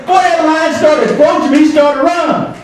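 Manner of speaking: ordinary talk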